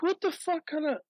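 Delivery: high, incredulous squeak; indignant shopper whose mushrooms are missing; outraged disbelief. Retold or imitated. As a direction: let it jump up in disbelief. Ranting at the store.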